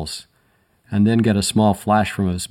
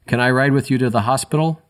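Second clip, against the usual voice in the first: mild yell at their backs; yelled after them; 5 semitones higher than usual. A narrator's reading. Narrator calling after the departing paramedics, mild yell. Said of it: "I yelled at their backs".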